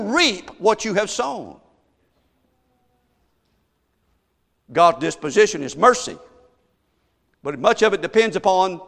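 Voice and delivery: loud, high, slowed-down preaching; preaching at full force. Acted. Preacher warning the congregation about their crisis, preaching hard.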